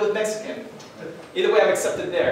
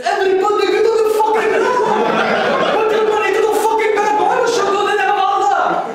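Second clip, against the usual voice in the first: yelling much higher and louder, hammed-up; mock terrorist yell; 13 semitones higher, 7 dB louder than usual. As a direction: bark it like a cartoon hijacker. Mock terrorist shouting, audience laughs.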